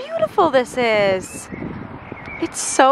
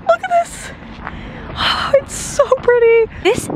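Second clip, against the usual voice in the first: high, delighted gasp; awed; 14 semitones higher than usual. Real, not acted. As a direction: gush with wonder. Awe at palace gates.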